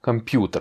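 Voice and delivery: "Computer" with PU stressed, last syllable dropped light. Shown as a demonstration. Teacher repeating the Russian model, stress on PU in "Computer". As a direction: lean on the "pu" of "Computer". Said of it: "j'accentue sur le U".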